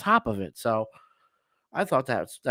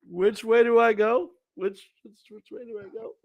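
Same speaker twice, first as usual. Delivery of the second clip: confused Coast Guard; 9 semitones higher than usual. Retold or imitated. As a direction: sound totally lost at sea. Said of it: "the Coast Guard is".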